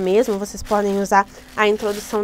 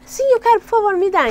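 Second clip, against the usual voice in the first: put-on high, eager kid asking the teacher; high childish plea; 8 semitones higher, 4 dB louder than usual. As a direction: ask like a child who wants candy. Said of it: "Daí você fala".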